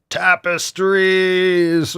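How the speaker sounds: long announcer shout; drawn-out shout. Pretend. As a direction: bellow it like a promo jingle. Repeats soundboard call.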